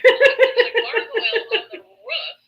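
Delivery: normal talking voice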